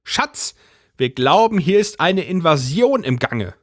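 high, grave conspiratorial wife; earnest alarmed housewife; serious wife. A narrator's reading. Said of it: "machte ein ernstes Gesicht".

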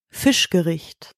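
ordinary talk

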